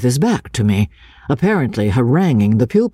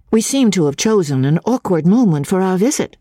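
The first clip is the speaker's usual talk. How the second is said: lady's voice; 8 semitones higher than usual. Acted. Miss Halcombe voiced.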